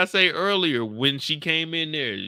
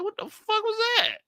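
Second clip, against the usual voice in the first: startled disbelief. Retold or imitated. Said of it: "I said".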